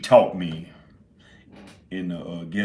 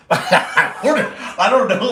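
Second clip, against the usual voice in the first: high, loud laughing through the words; helpless laughter; 13 semitones higher, 11 dB louder than usual. Real, not acted. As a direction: giggle out I don't know. Laughing at own ramble.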